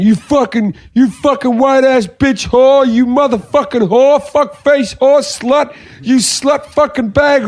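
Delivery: high, drawn-out tirade of retold abuse; mock yelling. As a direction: imitate a man ranting insults at high pitch. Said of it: "he starts yelling".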